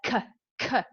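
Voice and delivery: "k" said as two separate short sounds; isolated K sounds. Shown as a demonstration. Teacher showing alliteration in an example, isolated "k" sounds. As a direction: sound out each "k" on its own. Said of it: "is alliteration".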